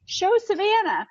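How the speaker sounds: high, slow, eager call; calling through glass. Retold or imitated. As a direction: project it through thick glass. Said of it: "And I'm like".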